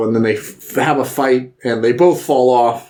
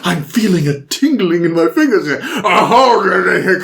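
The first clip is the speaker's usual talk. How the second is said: higher, hammed-up character voice, half laughing; imitated drinker; 5 semitones higher, slower than usual. Host retelling the drink-off, hammed-up character voice. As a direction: ham up the drunken elf.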